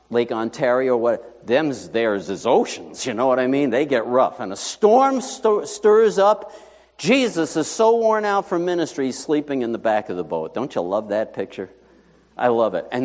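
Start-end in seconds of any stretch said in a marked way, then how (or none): none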